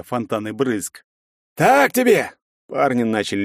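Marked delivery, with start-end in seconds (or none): 1.5-2.3 high, loud victory shout; торжествующий крик. A narrator's reading. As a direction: yell it at the beaten enemy. Бойцы добивают монстра. (торжествующий крик бойца)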